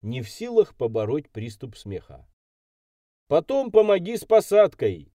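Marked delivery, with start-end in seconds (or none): 3.3-5.1 loud, high call; shout after her. A narrator's reading. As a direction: call after someone walking off. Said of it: "крикнул я ей вдогонку".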